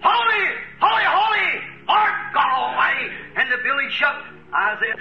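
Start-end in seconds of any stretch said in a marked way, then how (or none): 0.0-3.1 high full shout of holy; hollered again. Acted. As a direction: belt it out like shaking the temple. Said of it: "he hollered again".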